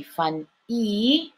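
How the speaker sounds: Fun, then a separate drawn-out E; long E ending. Shown as a demonstration. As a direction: split off and stretch the E. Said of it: "it's the E".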